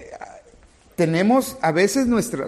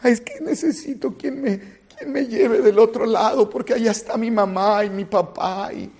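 half-laughing imitation of a crying plea; mock tearful plea; 4 semitones higher than usual. Needy person begging the boatman, mock tearful. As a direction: play a needy person whimpering for help, lightly. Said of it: "estamos a lo mejor llorando".

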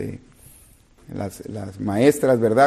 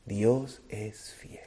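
whisper; 3 semitones lower, 11 dB quieter, much slower than usual. Real, not acted.

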